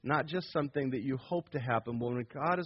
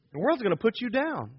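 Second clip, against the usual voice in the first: ordinary talk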